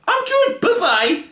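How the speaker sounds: high squeaky mock Superman brushing it off. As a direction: chirp it like a scared hero bailing out. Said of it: "And Superman's like".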